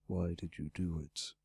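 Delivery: low, soft dragon voice; great red dragon. Acted. Dragon asking his rescuer the harder question, low and soft. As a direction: probe quietly in a deep dragon pitch.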